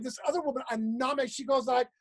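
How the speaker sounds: normal talking voice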